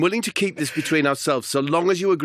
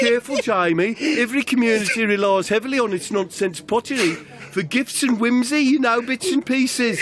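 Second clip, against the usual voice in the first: much higher, bright-toned hostess voice; chirpy Demaria; 12 semitones higher than usual. Acted. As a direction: fuss over him like a cheerful waiter. Demaria voice again.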